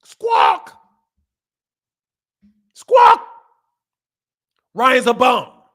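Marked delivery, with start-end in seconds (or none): 0.0-0.8 deep shouted crow squawk; low squawk. Retold or imitated. Mocking critics as crows, deep shouted squawk. 2.7-3.3 high, loud crow squawk. Retold or imitated. Mocking critics as crows, high loud squawk.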